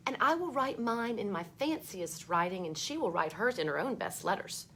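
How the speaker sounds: put-on bright read-aloud voice for children; storybook reading. A narrator's reading. Teacher reading a book to kids, lively read-aloud.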